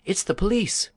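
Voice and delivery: girl pretends alarm to scare the bandits. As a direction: cry out as if terrified, faking it. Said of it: "cried out".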